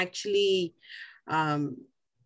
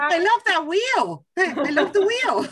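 delighted; 10 semitones higher, 3 dB louder than usual. Real, not acted. Delighted by the raffle wheel.

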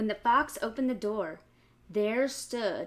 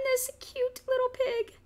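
cute squeal; 6 dB louder than usual. Acted. Reading to kids, cooing over the happy piglet. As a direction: coo it like the pig is adorable.

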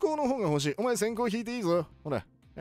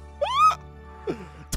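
high-pitched laugh. Real, not acted. Wins the game.

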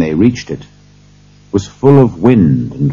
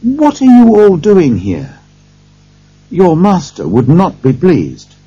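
high-pitched, raised-voice reproach; indignant scolding; 11 semitones higher, 5 dB louder than usual. A narrator's reading. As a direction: take the servants to task, pitch right up. Lawyer scolds gathered servants.

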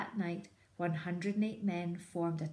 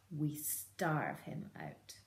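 soft, slow, menacing; sinister quiet; 3 semitones lower, 5 dB quieter, much slower than usual. Acted. Bean proposing a plan, slow and soft. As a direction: let it slide out slowly. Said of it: "he said".

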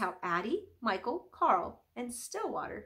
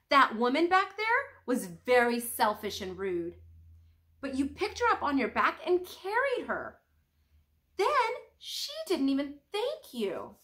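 put-on a touch of an indignant young monk, louder; sulky young monk blurting out his complaint; young monk complaining; 5 semitones higher, 7 dB louder than usual. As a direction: let the grievance spill out. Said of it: "he spoke out".

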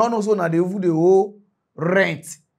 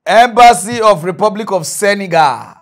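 roll-call announcement; 8 dB louder than usual. Acted. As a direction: announce it like a register. Reading out a debtor roll.

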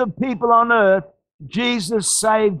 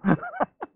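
quick high giggle; amused rising laugh; 6 dB quieter than usual. Real, not acted. Preacher laughs again.

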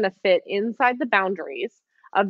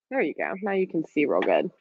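ordinary talk, nothing marked

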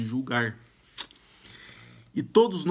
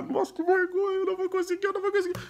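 high, whiny voice of an anxious inner thought; a nervous stutterer's inner voice whimpering, high-pitched; mock panic; much faster than usual. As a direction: fret like you're sure you'll fail.

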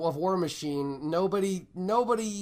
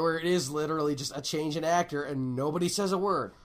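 ordinary talk

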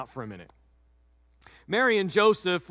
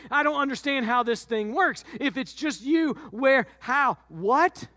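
confused Joseph; 6 semitones higher than usual. Acted. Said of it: "he's like".

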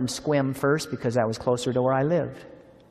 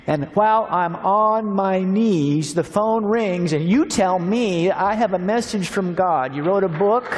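higher, louder, slow amazed outburst; overwhelmed amazement; 7 semitones higher, 5 dB louder, slower than usual. Retold or imitated. Kenneth telling the pastor the phone rang mid-prayer, amazed and louder. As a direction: let the wonder burst out slowly.

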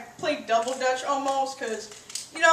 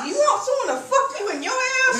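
mocking incredulous; 8 semitones higher, faster than usual. Mocking sagging pants.

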